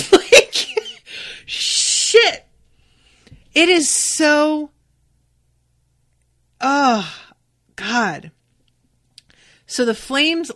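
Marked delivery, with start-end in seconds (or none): 0.1-8.3 much higher and louder, breathless dismay; real gushing reaction to a plot twist; high, loud, slowed oh-God exclamation; overwhelmed. Real, not acted. Reacting to the dramatic moment.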